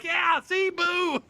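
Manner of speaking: put-on high, loud boo at the girl; gleeful boo. Him joining the crowd booing the little girl, gleeful boo. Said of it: "And I'm like".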